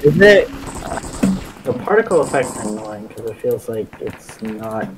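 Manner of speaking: ordinary delivery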